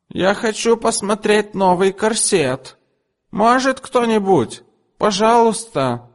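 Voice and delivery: very high, slow mock wail; wailing customer. A narrator's reading. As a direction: pretend nobody is there. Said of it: "завопила она". (очень высокий, протяжный притворный вопль)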